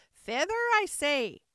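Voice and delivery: high, loud bossy command; insistent Sheep. A narrator's reading. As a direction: insist loudly as the cross Sheep. Sheep repeats her command.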